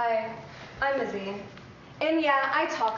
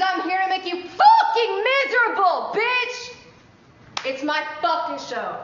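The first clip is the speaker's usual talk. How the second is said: hyped shout; 5 semitones higher, 5 dB louder than usual. Acted. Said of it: "The same thing?".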